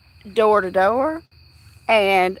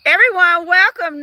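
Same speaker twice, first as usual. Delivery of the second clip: calling out; 6 semitones higher, 5 dB louder than usual. Real, not acted. Announcing a guest.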